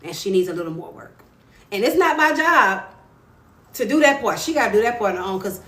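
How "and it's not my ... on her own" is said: louder, higher, insisting; emphatic declaration. Real, not acted. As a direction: preach it firmly. Emphatic preaching to viewers.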